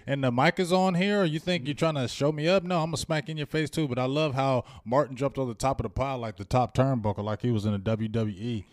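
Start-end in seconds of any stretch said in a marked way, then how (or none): 0.1-3.9 higher, louder, fast trash talk; loud, fast, cocky taunt; swaggering loud taunts. Retold or imitated. Acted fight taunt.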